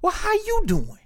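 put-on high, sweet old-lady voice; grandmother voice. Grandmother warmly greeting her grandson. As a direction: sound like a kindly grandma. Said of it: "And she be like".

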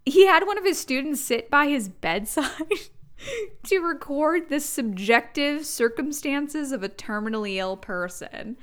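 ordinary delivery

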